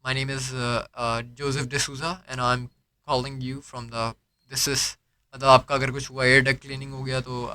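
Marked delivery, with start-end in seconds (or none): 0.1-5.0 slow, soft, polite agent script; lightly voiced phone agent introducing himself; call center intro demo. Shown as a demonstration. Agent demos his call opening to a customer, slow and polite. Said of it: "introduction पहले मैं दे रहा हूँ".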